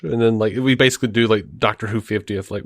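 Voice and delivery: ordinary talk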